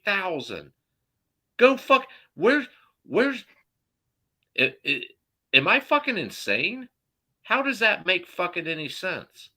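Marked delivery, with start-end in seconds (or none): 1.6-9.5 exasperated outrage. Real, not acted. Venting to the hosts about the pay gap, high and exasperated. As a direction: let it burst out high with disbelief.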